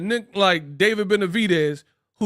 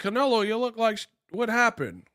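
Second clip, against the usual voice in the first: interviewer voice; 6 semitones higher than usual. Retold or imitated. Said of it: "They're like".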